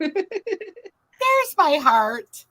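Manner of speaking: There's my heart stretched very slow and high; drawn-out sing-song. Real, not acted. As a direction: croon "There's my heart" in a long slow rise.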